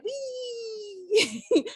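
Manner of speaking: high squealing whee with a laugh; a mouse having the time of its life mid-leap; gleeful mouse. Pretend. Mouse launching off the loft railing, squealing with glee. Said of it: "he probably was like".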